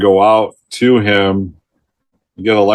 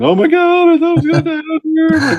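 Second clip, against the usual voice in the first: very high, fast mock wail; hammed-up whimpering of a scared hunter; lost hunter rescued in the dark, mock sobbing falsetto; crying lost hunter. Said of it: "he's crying".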